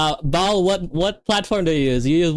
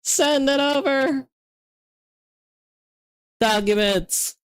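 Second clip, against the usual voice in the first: normal talking voice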